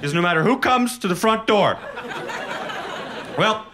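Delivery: loyal dog bragging about guarding; eager dog. Retold or imitated. Dog voice continues.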